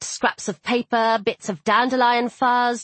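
plain reading voice

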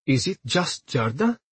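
soft question rising at the end; hopeful. A narrator's reading. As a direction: ask with timid hope. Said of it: "she asked hopefully".

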